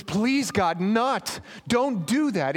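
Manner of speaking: high, urgent begging; Moses pleading. Acted. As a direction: cry out to stop God. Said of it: "And Moses says".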